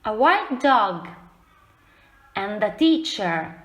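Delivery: slow, lively storybook recap; reading to children. A narrator's reading. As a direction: read the ending to the class with relish. Teacher reads story finale aloud.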